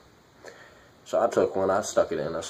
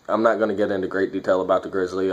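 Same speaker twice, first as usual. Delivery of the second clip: normal talking voice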